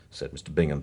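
ordinary delivery

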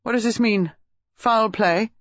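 high, sharp demand. A narrator's reading. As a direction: press for an answer as a shocked father. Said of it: "Major Reeves said sharply".

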